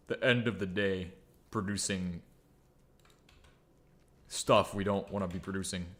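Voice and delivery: ordinary talk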